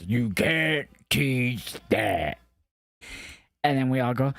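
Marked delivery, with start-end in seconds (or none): 0.1-2.4 very slow, heavy mock catchphrase; lightly voiced slow big guy; mock Big Bill. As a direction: drag out the catchphrase like a big man. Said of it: "he's like".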